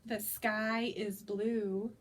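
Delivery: usual speaking voice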